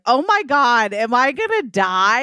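giddy pretend fear; mock alarm. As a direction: act scared for your life, in fun. Acts own panic on runaway sled.

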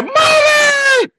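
put-on very high, piercing child's scream; a little kid shrieking for his mother in terror; screaming for mommy. Billy seeing the witch and crying for mom, terrified high scream. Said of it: "started screaming and shit".

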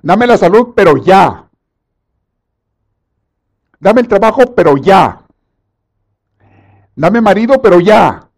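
loud, high, impatient demanding; mock desperate believer insisting on right now; desperate pleading prayer. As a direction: whine at God to hurry up. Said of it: "hay gente desesperada que le dice a Dios".

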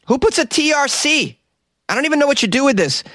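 very high-pitched, incredulous protest; squeal-high comic exasperation at the spelling; exasperated disbelief. Real, not acted. Host defeated by a patron's surname, exasperated.

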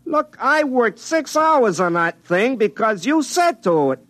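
exasperated, pitch climbing high and slow; losing patience after six hours of work; worked-up complaint, drawn out and high; getting mad. Acted. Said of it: "Don't get mad".

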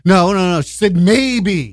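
high, slow swooning protest; giddy mock insistence. Imitating smitten friend dismissing questions, giddily insistent. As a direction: brush off questions, then gush.

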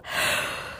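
sharp soft gasp; dramatic gasp. A narrator's reading. Narrator gasps at the story's danger, soft and sharp. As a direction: gasp at the leeches.

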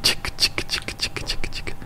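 whispered rhythmic whirr of a spinning disc; imitated flying sound. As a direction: tick it out very softly without voice. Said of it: "it's like".

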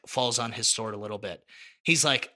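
normal talking voice

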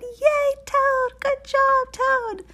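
very high, loud cheering; yelling in celebration. A narrator's reading. Girls celebrate the toad's first wave, high joyful yelling. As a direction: shout high and loud with delight. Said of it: "the girls were yelling".

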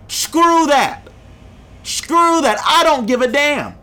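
fan venting at the radio pundits, fired up and angry; real angry outburst. Real, not acted. Sports podcaster dismissing pundits, in a real angry voice. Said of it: "right now I'm gonna be emotional".

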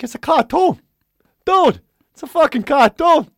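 put-on loud, high, exasperated New York accent; slow it down in mock outrage as a New Yorker; intense New Yorker. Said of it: "he's very intense".

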